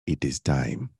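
low, soft, solemn Rafiki voice; quiet, low Rafiki pronouncement. Retold or imitated. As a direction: intone it like the wise old mandrill. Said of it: "like Rafiki said in Li-, in The Lion King".

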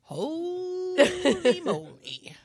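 very slow, laughing. Real, not acted. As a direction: drag it out while laughing. Laughing reaction.

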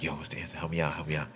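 very soft, low retold plea; furtive undertone. Zach begging for test answers in class, furtive undertone. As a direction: sneak it out under your breath.

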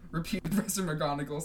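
plain reading voice

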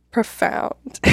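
ordinary talk

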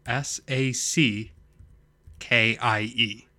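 slow, letter by letter; spelling it out. Shown as a demonstration. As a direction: spell it out with long pauses between groups. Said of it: "I'm gonna spell it for you".

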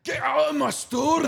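high, loud hammed-up Scottish shout; mock Scottish shopkeeper. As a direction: bark him out of the shop in a put-on Scottish accent.

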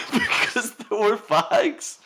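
laughing while insisting; high laughing protest. Real, not acted. Playful argument, laughing.

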